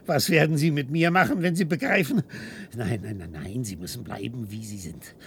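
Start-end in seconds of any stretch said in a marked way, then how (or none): none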